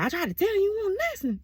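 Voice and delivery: high-pitched mock pleading; hammed-up high complaint; put-on complaint. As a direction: whine it up high, playfully exasperated. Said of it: "I like talking like that".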